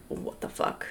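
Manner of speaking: hushed, voiceless re-enacted disbelief; stunned whisper. Retelling their reaction to the ghost recording, stunned whisper. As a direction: whisper it, as if freshly spooked.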